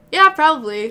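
high, loud mock-flustered answer; hammed-up nervous yelp; retold nervous reply. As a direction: act out a shaken, too-quick agreement. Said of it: "I'm straight up shaking".

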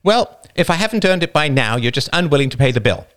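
the wife, brisk and dry; wife retorting. Acted. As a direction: shoot back briskly.

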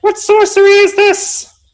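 high squeaking fey alarm; alarmed brownies. Retold or imitated. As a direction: shriek like startled tiny creatures. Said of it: "they're probably like".

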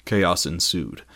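ordinary delivery

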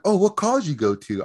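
high, chirpy curious question; playing a friendly waitress.

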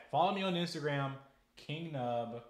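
an enthusiastic sign-off plug; upbeat plug. Real, not acted. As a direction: pitch your channel with a bright announcer push. Plugging social media.

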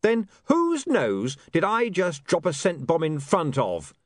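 slow, pleased with himself; triumphant. A narrator's reading. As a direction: savour the winning point. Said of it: "said William triumphantly".

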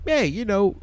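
high, soft comic catchphrase voice; Rodney Dangerfield. Retold or imitated. Friend imitates the comedian's patter, high and soft. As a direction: toss it off as a comedian impression. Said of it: "it's Rodney Dangerfield".

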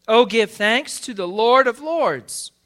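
high, loud, slow proclamation; ringing, exultant psalm reading; exclaimed praise. Real, not acted. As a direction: belt out the scripture line, just short of shouting. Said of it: "we should be shouting".

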